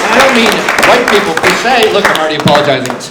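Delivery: loud, insistent rant; loud comic rant; emphatic. Real, not acted. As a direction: push it hard over the crowd.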